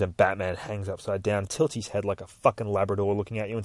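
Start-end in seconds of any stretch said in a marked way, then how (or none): none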